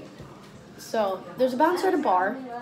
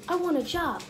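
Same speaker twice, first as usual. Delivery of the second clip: small guy's high voice, higher; high squeaky voice; 7 semitones higher than usual. Retold or imitated. Small dude asks bouncer for manager, high voice. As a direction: pipe it up high like a tiny dude. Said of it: "his voice is really high".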